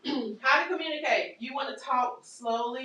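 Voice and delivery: ordinary talk, nothing marked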